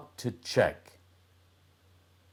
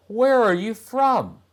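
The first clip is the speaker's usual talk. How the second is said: put-on boy's voice; 13 semitones higher, 7 dB louder than usual. Boy asks the girl.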